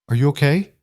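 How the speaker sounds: usual speaking voice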